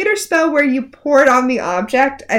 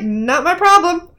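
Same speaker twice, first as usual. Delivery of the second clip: slow, higher-pitched mock indifference; casually evil; 6 semitones higher, slower than usual. Co-host imitates villain shrugging off doom, casually evil. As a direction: toss it off like an unbothered villain. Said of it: "Rumple's delightfully evil in this scene".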